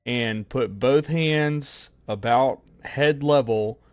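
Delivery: measured, spaced-out phrases; careful and emphatic, pausing between phrases; slow, deliberate statement. Real, not acted. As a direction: spell out the plan slowly, step by step. Player answers demand for clarity.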